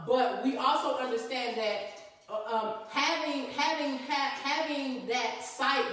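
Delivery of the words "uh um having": usual speaking voice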